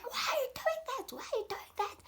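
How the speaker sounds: very high-pitched indignant yell; imitated shouting dad. Dad to his boys about the dogs, shouting. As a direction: demand an explanation in a shrieky mock shout. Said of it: "he's shouting at them".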